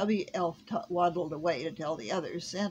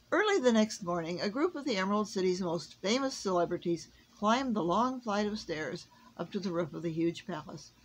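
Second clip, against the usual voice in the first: plain reading voice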